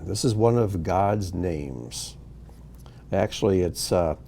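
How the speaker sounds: normal talking voice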